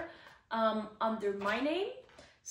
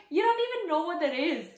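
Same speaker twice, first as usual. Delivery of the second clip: excited; 7 semitones higher, 7 dB louder, faster than usual. Retold or imitated. Staff teasing her, she's excited.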